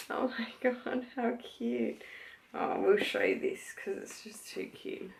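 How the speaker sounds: soft unvoiced whisper, delighted; gushing whisper. Real, not acted. To viewers over a cute keepsake, gushing whisper. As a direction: breathe it out in awe over something cute.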